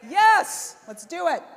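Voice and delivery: loud, high cheer to fire up the room; pumped-up crowd rally shout. Real, not acted. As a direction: shout yes like a hype leader. Speaker cheers to rally audience.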